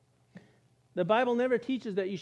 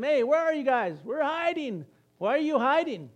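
higher, louder calling out, hey where are you; calling God; 6 semitones higher, 4 dB louder than usual. Acted. God calling to hiding Adam and Eve, playful. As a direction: call out playfully like God searching the garden.

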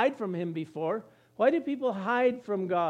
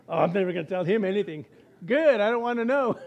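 louder, quicker joking voice; mock confessor; 6 dB louder than usual. Confessor joking with a sinner, mock gossip. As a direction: play the gossipy confessor for laughs.

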